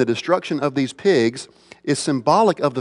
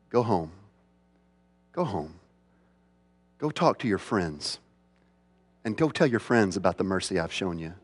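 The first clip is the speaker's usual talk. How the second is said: much softer, lower, tender command; gentle Jesus; 7 semitones lower, 10 dB quieter than usual. Acted. Preacher giving Jesus's words to the healed man, hushed and gentle. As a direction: soften right down, like a kind master sending him home.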